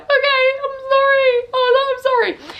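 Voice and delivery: high-pitched, hammed-up blubbering; exaggerated tearful squeal; mock crying. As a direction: wail the apology like an imitated sobber. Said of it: "I was crying my eyes out".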